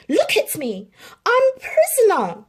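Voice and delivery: high, loud angry cry; shouting. A narrator's reading. Lady shouts at Tramp, angry. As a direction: shout it out in hurt anger. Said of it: "she shouted".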